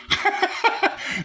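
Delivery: short, high-pitched cackle; real laughter. Real, not acted. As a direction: let out a high burst of laughter. Laughs while teasing Dina.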